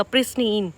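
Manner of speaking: प्रिस्टीन with a long "ee", said softly; low, soft प्रिस्टीन ending like "teen"; corrected pronunciation. Shown as a demonstration. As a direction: correct it to प्रिस्टीन, second syllable "steen". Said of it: "प्रिस्टाइन नहीं बोलेंगे".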